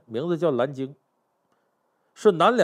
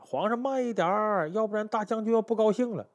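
calling out from behind; 6 semitones higher, 3 dB quieter than usual. Acted. Minister behind hails the galloping emperor, calling out. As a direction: stretch it out high, like hailing a rider ahead. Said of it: "这崔继书在后边就喊".

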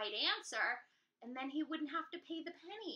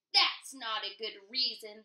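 troll voice; 3 semitones lower, 7 dB louder than usual. Acted. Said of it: "the troll said".

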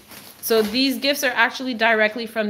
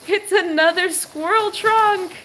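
higher, slower, laughing through the words; laughing delight; 10 semitones higher, 3 dB louder than usual. Real, not acted. Owner delighted by the new squirrel toy, laughing as she speaks. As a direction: laugh as you announce it.

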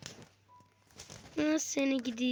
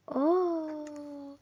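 usual speaking voice

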